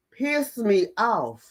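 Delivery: ordinary talk, nothing marked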